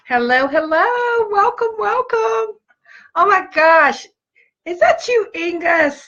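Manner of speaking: high, delighted sing-song hello; light up as each friend joins; squealing welcome to friends; gushing greeting. Real, not acted. Greeting live viewers, gushing.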